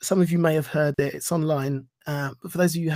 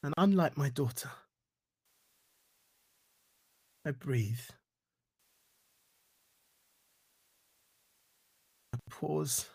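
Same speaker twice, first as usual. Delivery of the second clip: fading to silence; 3 semitones lower, 11 dB quieter than usual. Acted. Poem fading to its close.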